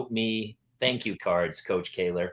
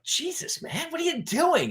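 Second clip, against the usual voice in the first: shocked. Retold or imitated. Reacting to yelling mom.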